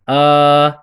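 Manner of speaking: آ held long and open. Shown as a demonstration. Teacher showing students the lengthened alif, stretched. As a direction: draw out آ like a held note.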